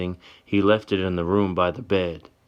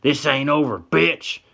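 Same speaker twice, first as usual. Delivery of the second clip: high, loud threatening snarl; loud, menacing sneer; hateful yell; 7 semitones higher, 4 dB louder than usual. Acted. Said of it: "the other one yells".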